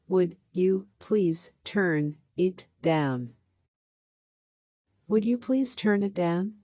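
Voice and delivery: normal talking voice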